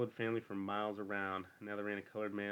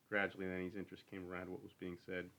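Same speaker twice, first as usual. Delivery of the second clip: ordinary delivery, nothing marked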